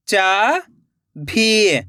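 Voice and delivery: चाभी split into चा, long pause, भी; rising चा, then falling भी; syllable by syllable. Shown as a demonstration. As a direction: sound out चाभी very slowly in two parts. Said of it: "एक एक अक्षर का उच्चारण करना है".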